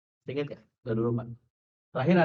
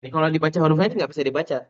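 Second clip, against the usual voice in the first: normal talking voice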